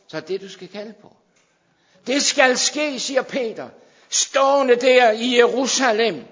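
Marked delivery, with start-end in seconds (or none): 2.1-6.2 loud, high, shouting preacher building to a peak; preaching at full force. Acted. Preacher shouting the promise to the congregation, full force. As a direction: proclaim it like a revival preacher.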